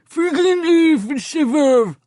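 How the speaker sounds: high, slow, lips-still ventriloquist voice. Acted. Narrator does ventriloquism at shoppers, high and slow. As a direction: throw the words like a ventriloquist dummy. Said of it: "in my best ventriloquist voice".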